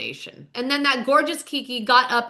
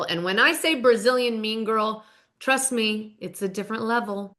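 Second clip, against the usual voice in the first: ordinary talk, nothing marked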